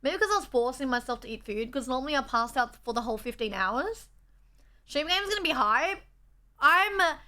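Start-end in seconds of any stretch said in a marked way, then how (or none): none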